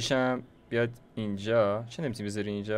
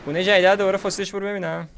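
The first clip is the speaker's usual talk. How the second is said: higher and louder, eager for the new fossil; excited; 8 semitones higher, 7 dB louder, faster than usual. Real, not acted. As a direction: jump up with real excitement. (با هیجان واقعی، زیر و بلند، برای فسیل گونه جدید)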